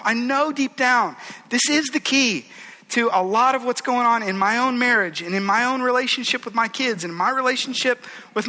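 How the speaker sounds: earnest confession turned rallying cry; impassioned preaching. Real, not acted. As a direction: preach it from the heart, building. Passionate sermon climax.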